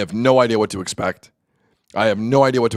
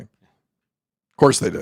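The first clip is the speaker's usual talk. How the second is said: sarcastic; 3 semitones higher, much slower than usual. Real, not acted. Sarcastic aside about benching.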